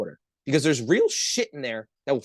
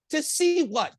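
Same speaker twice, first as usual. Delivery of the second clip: high-pitched scornful disbelief; incredulous retort; 11 semitones higher, slower than usual. Retold or imitated. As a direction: throw the question back, pitched way up. Mocking retort to goggles offer.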